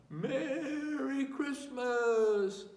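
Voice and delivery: high, loud and slow, drawn out; shouted greeting. A narrator's reading. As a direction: call it from a moving train. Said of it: "he shouted".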